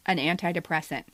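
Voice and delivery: ordinary talk, nothing marked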